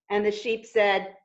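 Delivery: ordinary delivery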